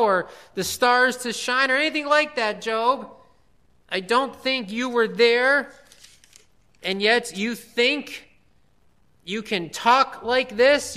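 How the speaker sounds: a booming voice of God demanding answers; God rebuking Job. Retold or imitated. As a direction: thunder down on Job like God himself. Preacher voicing God rebuking Job.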